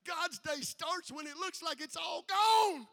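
slow, loud, full-force sermon line; shouted preaching. Acted. Preacher to congregation, slow loud shout. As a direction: hammer it home to the crowd.

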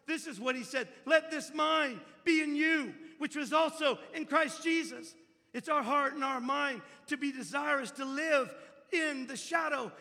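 loud, high, full-force preaching. Acted. Preacher challenging the congregation to a Christlike life, full-force preaching. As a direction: preach it out with fire.

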